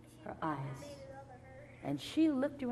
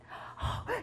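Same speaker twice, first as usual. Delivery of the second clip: sharp intake of breath; storyteller's suspense gasp; excited gasp; 3 dB quieter than usual. Acted. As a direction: gasp at a sudden discovery. Girl spots the open gate.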